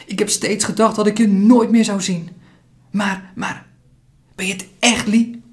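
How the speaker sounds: he can't believe his friend is really here; disbelief and astonishment. Acted. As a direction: Kwon telling Lee in stunned disbelief. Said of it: "Ongeloof, vermengd met verbazing".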